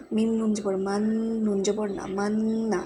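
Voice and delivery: মান্না with the n hummed through the nose; nasal gunnah. Shown as a demonstration. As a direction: hold the ন in মান্না as a long nasal hum. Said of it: "এই নুনটাও গুণনা হবে".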